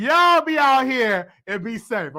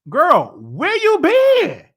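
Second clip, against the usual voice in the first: drawn-out call-out; 9 semitones higher, 9 dB louder, much slower than usual. Pretend. Calling out Iggy again.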